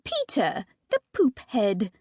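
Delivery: slow, hammed-up mock British; goofy British drawl; silly British accent. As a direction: clown for the little sisters in a British accent. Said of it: "trying to make them laugh again".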